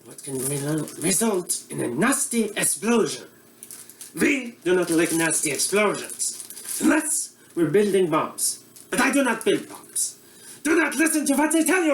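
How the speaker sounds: put-on comic Eastern European accent as a bumbling TV cook; broad Russian accent; mock Russian accent. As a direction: play a hammy Russian-accented chef host. Comedic Boris cooking show character.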